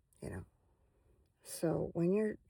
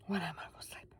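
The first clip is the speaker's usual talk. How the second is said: whisper; 7 dB quieter, faster than usual. Real, not acted. Sleepy trailing off before bed.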